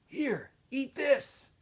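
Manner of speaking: a hint of a weary boy; lightly voiced Stanley. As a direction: coax him to eat, slowly. Stanley voiced offering onion.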